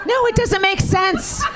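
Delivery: high, shouted comic outrage; exasperated rant. Acted. Mother on son's wrong drowning grammar, exasperated shouting. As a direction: rant it at the top of your range.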